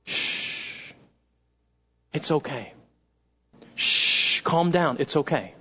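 hushed, soft shushing and comforting; calm a crying child with a whisper; soothing mom. Acted. Mother soothes her sobbing little boy after a bike fall, hushed and tender. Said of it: "what does mom do?".

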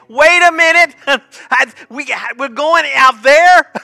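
high, loud, frantic mock panic; panicked Moses. Moses terrified at the water's edge, high and frantic. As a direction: squeal it out like you're sure you'll drown.

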